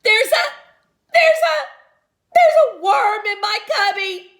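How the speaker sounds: high, loud frightened stammer; panicked. A narrator's reading. Girl telling teacher about worm, panicked and loud. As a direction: blurt it out in a panic, squealing.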